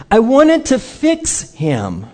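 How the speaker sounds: slow, high and insistent, FIX held long; emphatic repetition. Real, not acted. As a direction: hammer FIX, drawing out each word. Repeats confession for emphasis.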